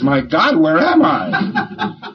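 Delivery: startled, slowed, laughing My God; hammed-up shock, half laughing; mock startled cry. Lecturer plays a guy popped out of his body, mock startled.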